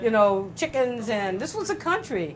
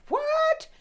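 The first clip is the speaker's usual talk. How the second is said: shocked what. Retold or imitated. Hearing his wife's suicide.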